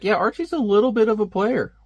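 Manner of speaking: mock Archie playfully; teasing remark. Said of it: "I was like".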